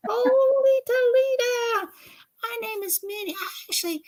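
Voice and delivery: high, excited Southern-lady puppet voice; squeaky puppet gossiping about Miss Southern Belle; Minnie the puppet. Acted. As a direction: pitch way up and gush like a chatty puppet. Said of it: "she's my puppet".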